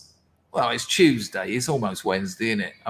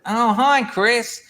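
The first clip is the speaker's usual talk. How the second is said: a lightly mimicked bloke, pitched much higher; chirpy caller, voice raised high; imitating the caller; 10 semitones higher, 4 dB louder, slower than usual. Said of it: "and this bloke said".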